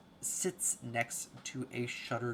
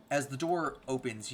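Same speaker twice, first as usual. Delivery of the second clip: ordinary talk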